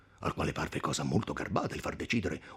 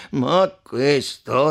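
loud, drawn-out protest; slow, insistent raised voice; shouting in argument; 9 semitones higher, 13 dB louder, much slower than usual. A narrator's reading. Podestà arguing back at the count at table, shouting. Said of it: "replicava non meno urlando".

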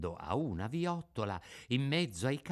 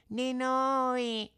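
very high, long drawn-out wail; echoing cat; 13 semitones higher than usual. Acted. Cat echoes the begging fox, wailing. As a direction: parrot the word in a thin feline whine. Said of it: "ripeté il gatto".

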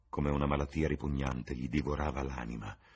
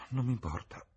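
with a sigh; 7 semitones higher than usual. A narrator's reading. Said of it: "aggiunse con un sospiro".

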